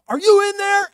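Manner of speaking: high, very loud demand; shouting down pipe. Retold or imitated. Pursuer leaning into furnace pipe, shouting after boys. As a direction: yell it into a long pipe.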